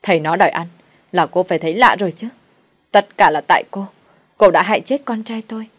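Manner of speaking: loud, raging blame; furious accusation. Acted. Grieving mother-in-law blames the widow for her son's death, furious shouting. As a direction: hurl the blame at her in a rage. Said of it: "nổi điên ... rồi chửi".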